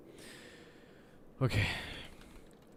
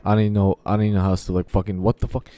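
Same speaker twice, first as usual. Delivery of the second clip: loud venting; 12 dB louder, faster than usual. Real, not acted. Loud venting, lost for words.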